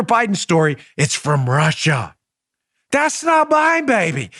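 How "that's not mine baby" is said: high, mocking spy catchphrase; Austin Powers. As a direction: toss off the groovy denial. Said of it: "They need Austin Powers".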